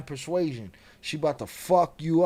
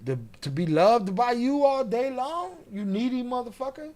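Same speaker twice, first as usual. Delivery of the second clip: higher, needy-hating cat voice; annoyed cat; 7 semitones higher than usual. Acted. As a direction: whine it as a cat fed up with cuddles. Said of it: "the cat's like".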